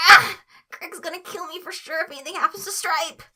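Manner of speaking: sudden high, loud panicked yelp into worried babble; panicked outburst. Acted. Tweek panicking that Craig will be angry, high frantic yelp. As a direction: blurt it out in high-pitched panic.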